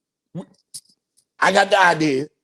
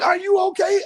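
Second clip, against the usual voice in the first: mock concern; 12 semitones higher than usual. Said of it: "they're like".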